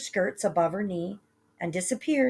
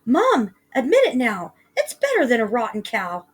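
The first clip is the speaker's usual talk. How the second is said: put-on loud, higher boyish cry; triumphant cry; 5 semitones higher, 7 dB louder than usual. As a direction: crow at your mother. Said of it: "Young Jack cried".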